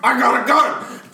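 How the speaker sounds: high, loud cartoonish yelling; Batman. Friend mocking Batman's part, high panicked yell. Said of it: "Batman was there saying".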